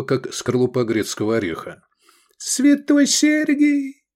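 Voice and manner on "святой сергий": sehr hohe, langsame Greisenstimme voller Ehrfurcht; ausrufender alter Mann. A narrator's reading. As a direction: ein kleiner Alter, der vor Freude aufschreit. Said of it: "воскликнул он".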